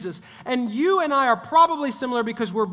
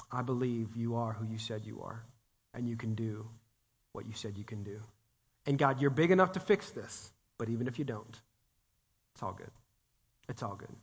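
soft surrender; 10 semitones lower, 11 dB quieter than usual. Retold or imitated. Quoting surrendered prayer.

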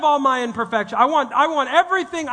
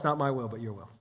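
hushed surrender; 10 semitones lower, 7 dB quieter than usual. Retold or imitated. Quoting Jesus' surrender prayer.

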